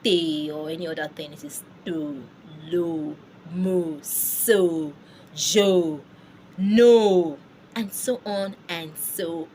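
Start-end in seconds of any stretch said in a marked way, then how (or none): 1.9-7.4 to, lo, mo, so, jo, no each drawn out with a rounded vowel; French E sound. Shown as a demonstration. Teacher drilling unaccented E after consonants, French pronunciation. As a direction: hold each of to, lo, mo, so, jo, no, never "tee" or "tay". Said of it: "do not try to pronounce it as".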